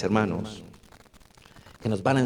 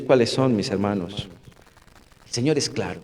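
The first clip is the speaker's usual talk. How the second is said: ordinary talk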